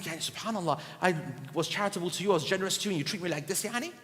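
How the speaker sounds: low, soft hurt complaint; hurt giver. Retold or imitated. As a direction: mutter it to yourself, wounded. Giver's hurt inner thoughts.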